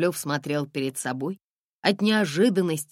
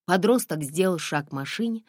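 plain reading voice

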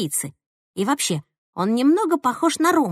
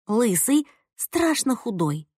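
plain reading voice

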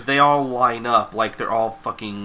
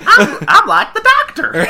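very high, loud squeal; laughing character voice; 8 dB louder than usual. Pretend. Host riffing as Applejack, high laughing mock boast.

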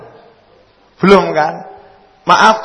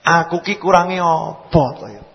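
ordinary talk, nothing marked

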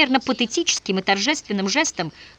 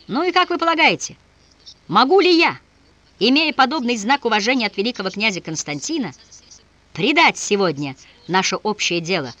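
impassioned appeal; 4 semitones higher than usual. Acted. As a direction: call out to their hearts. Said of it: "воззвал к умам и сердцам". (страстный громкий призыв)

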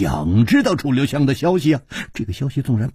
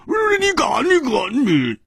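very high-pitched, sputtering yell; strangled, indignant shout; outraged; 12 semitones higher than usual. A narrator's reading. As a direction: shriek a threat in outrage. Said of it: "眼睛果然瞪得比鸡蛋还大".

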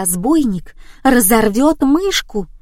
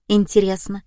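usual speaking voice

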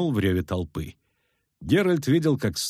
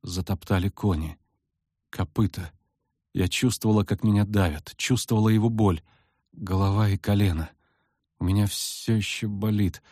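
speaking with difficulty; 3 semitones lower than usual. Acted. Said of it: "с трудом проговорила Цири".

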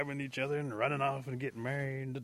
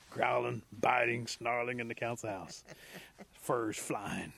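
plain reading voice

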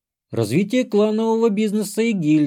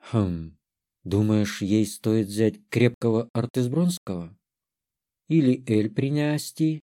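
низкий, негромкий голос размышляющей женщины; прикидывай вслух, низко и тихо; задумчиво; 5 semitones lower, 4 dB quieter than usual. A narrator's reading. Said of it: "задумалась она". (задумчиво, вполголоса)

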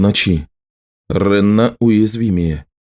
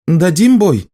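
shouting; 8 semitones higher, slower than usual. A narrator's reading. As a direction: yell it over pounding hooves. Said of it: "крикнула Ренна Орлену".

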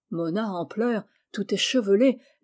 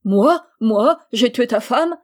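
much louder, stunned disbelief; incredulous shout; 2 semitones higher, 7 dB louder than usual. Acted. King accused of killing the bride, incredulous loud outburst. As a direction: throw the accusation back in disbelief.

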